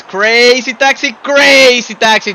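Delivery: loud, high goal call; excited goal call. Real, not acted. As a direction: shout the name as he scores. Commentator calls the deciding goal.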